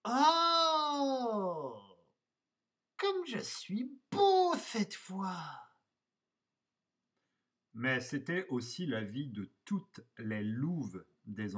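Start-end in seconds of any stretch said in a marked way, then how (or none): 0.1-5.6 high, drawn-out wolf admiration; slow, high put-on voice, thrilled; delighted exclamation. A narrator's reading. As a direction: gasp in wonder at your own beauty. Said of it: "et s'exclama".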